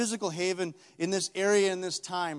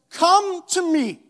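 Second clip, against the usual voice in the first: slow, high, loud call; Jesus calling; 13 semitones higher, 14 dB louder, much slower than usual. Acted. Jesus calling the listener to him, loud and slow. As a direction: call out with all your heart. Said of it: "Jesus says".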